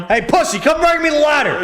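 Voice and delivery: high, loud mock demand; imitated bossy wife. Co-host playing the wife demanding a lighter, high mock yell. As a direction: yell the order as a caricature wife.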